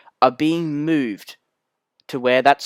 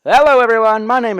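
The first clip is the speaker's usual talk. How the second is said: big show greeting; 10 semitones higher, 7 dB louder than usual. Acted. Presenter opening the monthly episode to viewers, big loud greeting.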